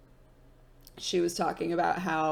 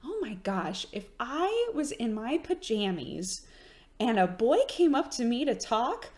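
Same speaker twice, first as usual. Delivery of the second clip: high, rising mom imitation, building to a laughing near-shout; mom's voice; 8 semitones higher than usual. Mom marveling at her daughter, building to laughing high shout. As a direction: mimic mom growing giddy, pitch climbing to a laughing cry. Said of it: "And she was like".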